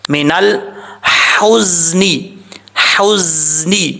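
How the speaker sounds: الحزن with a light breathy ح and a buzzing ز, drawn out slowly; light h recitation. Shown as a demonstration. Teacher showing the correct light ح in الحزن, slow chant. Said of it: "ہا بریک پڑھا جائے گا،".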